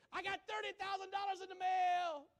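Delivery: testimonial lady. Retold or imitated. Preacher mimics a TV testimonial lady, high and loud. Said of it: "one lady said".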